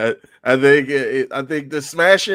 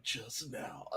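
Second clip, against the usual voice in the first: whispered imitation; 5 semitones lower, 26 dB quieter than usual. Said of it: "I was like".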